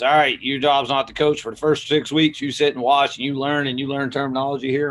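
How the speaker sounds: Southern accent, lightly hammed-up coach laying down rules. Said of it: "my voice went Southern when I went to strength coach".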